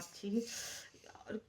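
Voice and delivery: breathy, barely voiced "छी" in slow disgust; whispered disgust. Real, not acted. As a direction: let the wince slip out in a whisper. Painful injection given again. (फुसफुसाकर घिन से)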